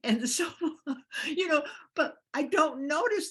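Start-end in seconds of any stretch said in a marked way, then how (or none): 0.5-1.2 high burst of laughter; real amused laugh; bright laugh. Real, not acted. Laughing at her own age joke. 1.3-3.3 higher, louder, still laughing; a touch of laughter in the voice; laughing through words. Real, not acted. Laughing after the age joke.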